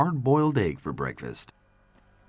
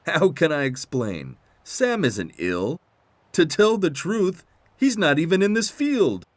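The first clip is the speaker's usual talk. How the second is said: lightly voiced higher character; explaining Roy; 11 semitones higher, 6 dB louder than usual.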